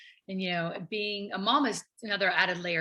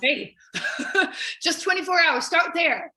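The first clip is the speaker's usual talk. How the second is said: laughing through it, pitched up and louder; laughing encouragement; 8 semitones higher, 7 dB louder than usual. Retold or imitated. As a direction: coax yourself kindly through a laugh. Voicing a cheerful coaching self-talk.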